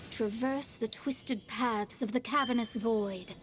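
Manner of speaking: ceremonial summoning chant, measured; an astrologist calling on the stars; solemn incantation. Acted. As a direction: intone it like a ritual invocation.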